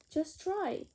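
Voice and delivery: ordinary delivery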